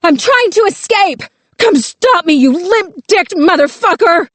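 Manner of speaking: very high screamed taunt; raw defiant scream. A narrator's reading. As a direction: goad the captor into coming down. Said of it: "but she still screamed".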